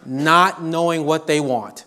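ordinary talk, nothing marked

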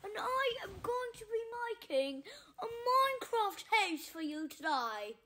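fake Irish accent. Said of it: "And yes, I am Irish".

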